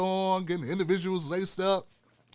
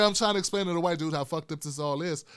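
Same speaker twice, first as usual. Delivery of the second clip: ordinary talk, nothing marked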